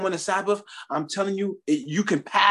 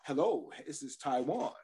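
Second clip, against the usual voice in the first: smooth ladies' man; 13 dB quieter than usual. Retold or imitated. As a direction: purr it like a club player. Smooth club persona on phone.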